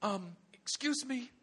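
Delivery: hesitant, slow, mock-British request; British accent. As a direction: ask timidly in a put-on British accent. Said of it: "he had to say that with a British accent".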